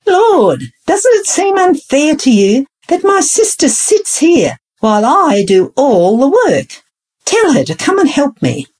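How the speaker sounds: pitched high, exasperated plea; cried out. A narrator's reading. Martha crying out to Jesus about her idle sister, pitched high and exasperated. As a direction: complain up high, close to breaking. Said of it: "She cried out to Jesus".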